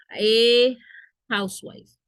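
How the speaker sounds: A said loud and long before housewife; stress on A. Shown as a demonstration. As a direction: hit the article A hard. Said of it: "el artículo a". (la A de A housewife muy marcada y fuerte)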